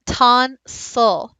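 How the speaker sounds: "tonsil" slowly broken as ton-sil; slow, split "tonsil" with a slight short i; drawn-out syllables. Shown as a demonstration. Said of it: "You almost hear the I".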